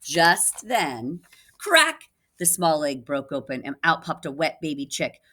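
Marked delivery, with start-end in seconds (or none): 1.6-2.1 cracking sound. Acted. Egg cracking sound effect.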